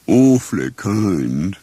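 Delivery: slow, low, mournfully grand donkey; grand Eeyore. Acted. Eeyore greets his friends, slow grand gloom. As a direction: drawl it out like a gloomy old donkey. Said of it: "Eeyore said grandly".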